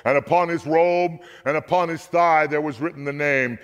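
usual speaking voice

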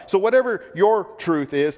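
usual speaking voice

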